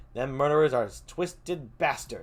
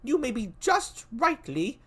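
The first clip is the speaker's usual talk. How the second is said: put-on much higher, cautious young prince; young Malcolm; 9 semitones higher, 4 dB louder than usual. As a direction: weigh each word warily, pitched high.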